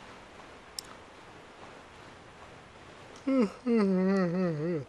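long high giggle; giggling. Real, not acted. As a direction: giggle fit. Laughs at own hiccups.

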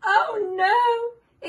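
high loud gasping oh no; hammed-up high cry of surprise; mock alarm. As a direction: act shocked for the child. Reading story to child.

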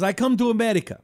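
lightly voiced Persian accent. Owner recounting his immigration, mild Persian accent. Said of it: "I went to the accent for a minute".

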